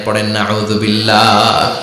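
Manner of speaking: ordinary talk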